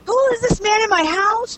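imitating a shocked mom finding a stranger at home; freaking out. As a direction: mimic a startled mom freaking out. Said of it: "And she's all freaking out".

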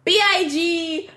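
ordinary talk, nothing marked

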